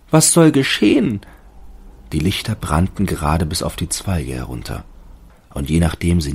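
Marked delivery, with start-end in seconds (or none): 0.1-1.2 hohe, langsame, staunende Baumstimme; Stimme des Baumes. A narrator's reading. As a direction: als ängstlicher Tannenbaum fragen. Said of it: "dachte der Baum". (hohe, zaghafte Baumstimme)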